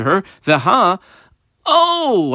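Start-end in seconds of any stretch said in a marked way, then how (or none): 1.6-2.3 "oh" sung out long and high; emphatic oh. Shown as a demonstration. Teacher pointing out the decisive word, emphatic "oh". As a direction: stretch "oh" high as a double-underlined word. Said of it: "I double underline the word oh".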